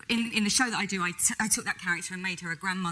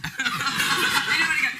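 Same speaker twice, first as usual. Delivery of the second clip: louder laughing repeat; 7 semitones higher, 7 dB louder, slower than usual. Real, not acted. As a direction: laugh harder the second time.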